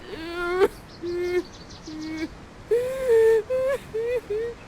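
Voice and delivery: high hammed-up sobbing wail; mock sobbing. Ferdinand weeping for his father, mock sobbing. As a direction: fake a big theatrical cry. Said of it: "You're crying now, Ferdinand".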